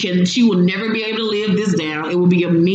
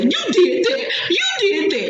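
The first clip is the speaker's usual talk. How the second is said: mock crying; 12 semitones higher than usual. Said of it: "fake ass crying".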